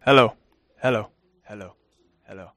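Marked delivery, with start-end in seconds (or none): none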